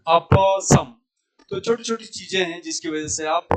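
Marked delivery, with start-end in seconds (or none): 0.1-0.9 अप as a loud a-pa, then सम; correct अप सम. Shown as a demonstration. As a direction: model अप with its final vowel, सम closed on m. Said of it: "ऐसे बोलना है". (अप का प पूरा, सम का म अधूरा)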